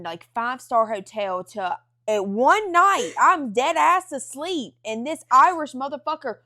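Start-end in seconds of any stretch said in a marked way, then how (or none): none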